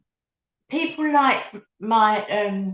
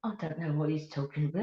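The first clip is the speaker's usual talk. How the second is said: much softer, quick, off to the side; muttered aside; 7 semitones lower, 10 dB quieter, faster than usual. Real, not acted. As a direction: mutter it to yourself, amused. Aside about a teasing comment.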